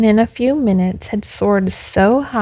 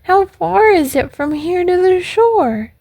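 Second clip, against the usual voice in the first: high, eager puppet question; acted urgent little puppet; breathless puppet; 9 semitones higher than usual. A narrator's reading. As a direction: ask it high-pitched, with a touch of breathlessness. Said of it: "asked Pinocchio breathlessly".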